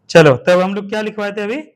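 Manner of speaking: loud call to regain attention; calling class back. Real, not acted. Teacher calling pupils back to dictation, loud.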